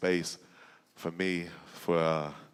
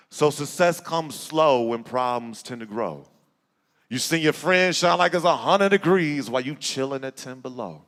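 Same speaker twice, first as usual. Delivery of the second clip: rising, rhythmic poem recitation; spoken word poem; 13 semitones higher, 11 dB louder than usual. Acted. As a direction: build the poem to a rousing peak. Recites his poem Dream Big.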